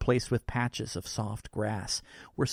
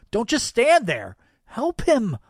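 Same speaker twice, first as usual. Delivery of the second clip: high, loud, urgent appeal; imploring; 11 semitones higher, 9 dB louder than usual. A narrator's reading. Stuck man imploring Santa to save the boy, urgent. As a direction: implore him to act right now. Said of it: "I implore him to do something".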